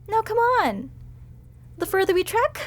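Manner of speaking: ordinary delivery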